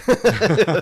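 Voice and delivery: loud high laugh; sudden loud laughing burst; burst of laughter. Real, not acted. Laughs at own reversal.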